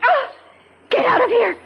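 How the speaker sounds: high shriek, then fast panicked yelling; startled scream. Acted. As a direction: jump in fright and drive him out. Iris screaming, caught with David.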